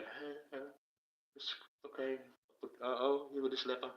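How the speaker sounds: soft, airy mock-gentle Jesus voice; a hint of a floaty, ethereal holy man; fairy-like Jesus. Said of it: "Speaking like a fairy".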